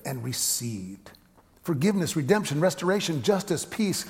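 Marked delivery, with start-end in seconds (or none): none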